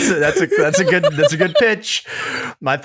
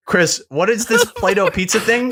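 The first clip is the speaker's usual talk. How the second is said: imitating Nick; 8 semitones higher than usual. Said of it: "He's like".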